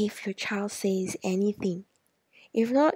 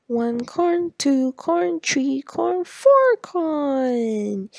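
counting corn higher and louder, building to four; excited counting; 5 semitones higher, 5 dB louder, much slower than usual. Shown as a demonstration. Parent counting corn with child, excitedly. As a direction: cheer each corn as you count. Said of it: "emphasize on the number four".

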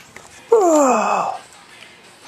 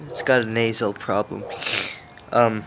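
usual speaking voice

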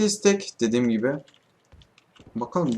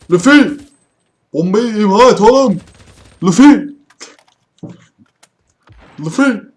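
loud urgent yell across the game; shouting at teammate; 9 semitones higher, 12 dB louder than usual. Real, not acted. Gamer yelling at teammate Luffy to defuse the bomb, loud and urgent. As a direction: call him out, full voice, urgent.

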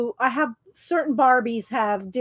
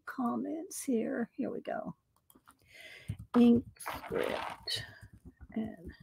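whisper; 12 dB quieter, slower than usual. Real, not acted. Reading chat under her breath.

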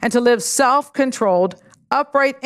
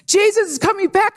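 retold excited yelling; 8 semitones higher than usual. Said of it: "I started yelling".

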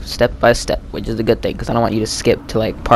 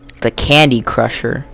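usual speaking voice

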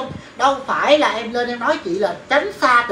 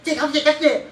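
higher, louder mock of a brusque denial; mocking curt refusal; 5 semitones higher, 5 dB louder than usual. Imitating the hairdresser cutting her off, mock indignant. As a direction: mimic her cutting in sharply. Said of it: "một người ngắt ngang".

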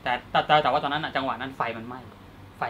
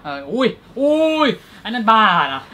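shocked disgust; 7 semitones higher, 10 dB louder, slower than usual. Real, not acted. Recalling gross Ichi the Killer scene.

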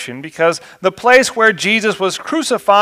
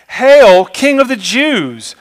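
higher, louder mock salute; mocking soldiers; 7 semitones higher, 5 dB louder, slower than usual. A narrator's reading. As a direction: jeer it like a sneering hail.

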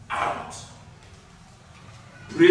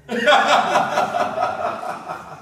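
real laugh; 7 semitones higher than usual. Real, not acted. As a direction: laugh out in a rolling run. Preacher amused by tongue-holding demonstration.

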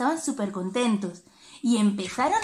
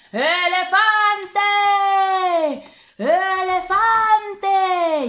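llamada alta y alargada; llámalo a lo lejos, estirando; llamada a gritos; 5 semitones higher, 5 dB louder, much slower than usual. Acted. Llamando al elefante. (la tortuga llama al elefante a gritos, alargando)

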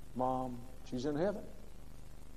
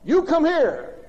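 loud, higher barked order to a dog; mock bossy command; 8 semitones higher, 9 dB louder, much slower than usual. Fellow ordering his dog around, mock barked command. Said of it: "he would say something like this".

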